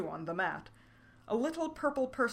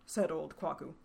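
usual speaking voice

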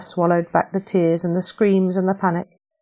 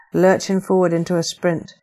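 usual speaking voice

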